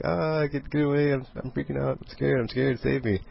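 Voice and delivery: high-pitched, hammed-up panic; mock freak-out. Hero terrified of a tiny bug, high mock panic. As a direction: whimper it out as a comic coward, high. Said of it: "just freaking out about it".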